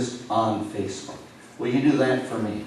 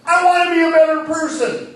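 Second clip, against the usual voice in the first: much higher, louder mock vow; hammed-up lofty promise; mock earnest wish; 8 dB louder than usual. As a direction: parody a grand resolution.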